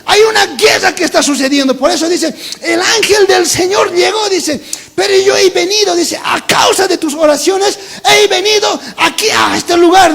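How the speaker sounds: loud, high-pitched shouted preaching; prédica a plena voz. Acted. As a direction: shout the angel's message to the congregation. Preaching at full force. (predicando a gritos, voz aguda y fuerte)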